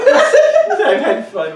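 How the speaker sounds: high, slow, laughing repeat; mocking echo. Host echoing guest's confession mockingly, laughing high. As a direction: parrot her words back in a laughing squeal.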